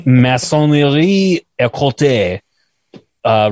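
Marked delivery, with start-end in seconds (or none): 0.1-2.4 slow, careful French attempt. Shown as a demonstration. Host braces himself, then tries slow careful French. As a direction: work through the French slowly. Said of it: "This is gonna be a bitch".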